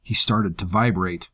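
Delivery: ordinary delivery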